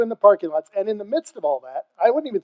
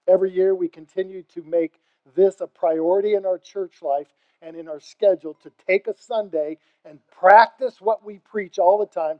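ordinary talk

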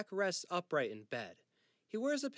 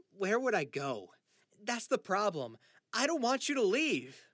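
upset; 7 semitones higher, 7 dB louder than usual. Acted. Son upset at dying father.

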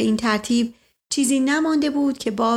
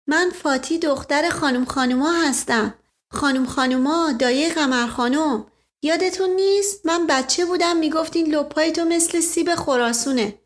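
put-on higher, girlish voice, giggly; bashful young girl; 5 semitones higher than usual. Fati reminding Asadollah who she is, higher girlish voice. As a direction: play a shy young maid reminding him.

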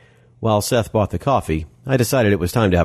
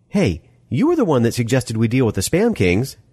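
Molly, testy woman; 6 semitones higher than usual. Acted. Molly's voice in narrated story.

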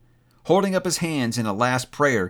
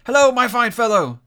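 high, loud greeting; hearty hail; 11 semitones higher, 7 dB louder than usual. A narrator's reading. Scrooge hailing the boy, hearty call. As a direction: call down jovially.

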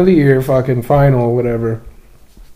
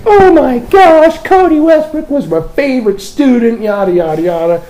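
gushing teacher; 13 semitones higher, 6 dB louder than usual. Retold or imitated. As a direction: ham up the delighted teacher, high and loud. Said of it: "And she's like".

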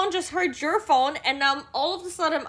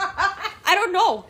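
laughing; 5 semitones higher, 4 dB louder, much slower than usual. Real, not acted. Laughing at own fate.

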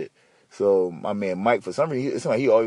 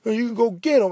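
high, sneering voice of a mean teacher; lightly shrill imitation of the teacher's taunt; mocking teacher; 12 semitones higher than usual. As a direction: mimic her taunt, nasty and high. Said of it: "she said some smart shit like".

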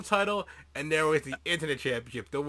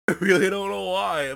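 laughing; 3 semitones higher, 5 dB louder than usual. Real, not acted. Laughing through mock repeat.

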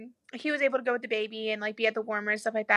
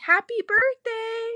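slow, higher sing-song greeting; cheerful announcement; 11 semitones higher, much slower than usual. Retold or imitated. Doctor announcing the newborn, slow, high and sing-song. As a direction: stretch it out like a happy doctor.

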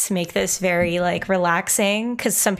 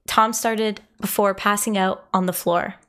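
usual speaking voice

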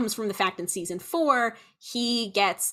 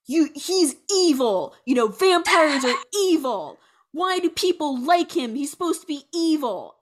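higher, exasperated mock complaint; retold upset; 6 semitones higher, 4 dB louder than usual. Show creator upset at actor for making villain cool, mock exasperation. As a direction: imitate someone indignantly protesting, leaning on each point. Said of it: "he was really upset at Marsters".

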